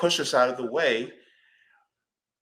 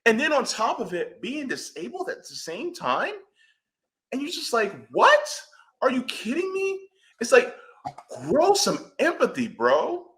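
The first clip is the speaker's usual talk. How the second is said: fired up; 11 semitones higher than usual. Real, not acted. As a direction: let the irritation pitch the voice right up. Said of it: "It just makes me mad".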